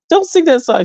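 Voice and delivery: high, whiny retold plea; high-pitched mock whimper; mock tearful plea. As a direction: beg as if about to cry, hammed-up. Crying mother begs daughter to stop.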